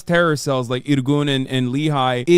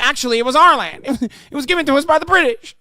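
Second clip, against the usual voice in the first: quick, higher, laughing mockery; mock smug claim; 8 semitones higher, 3 dB louder, much faster than usual. Streamer mocking the debater's claim, fast and laughing. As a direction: rattle it off while cracking up. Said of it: "There's like".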